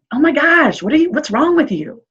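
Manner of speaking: put-on nervous parent to an anxious kid, high and worked up. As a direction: play the frazzled parent losing it. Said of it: "getting all worked up and nervous".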